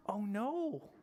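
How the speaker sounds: hammed-up dismay; mock alarm. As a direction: act surprised for the kids. Lost teddy bear worry.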